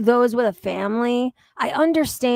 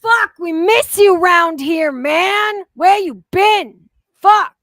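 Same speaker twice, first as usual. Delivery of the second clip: louder and higher, overjoyed welcome; excited shout; 6 semitones higher, 8 dB louder, slower than usual. Real, not acted. Welcoming Yo Mama back to the stream, excited shout. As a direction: shout it like he's finally home.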